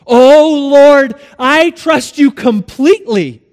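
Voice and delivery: high, loud desperate assurance; hammed-up fervent declaration; fervent pleading. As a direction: swear it eagerly, calling upward. Desperate man pleads with the Lord.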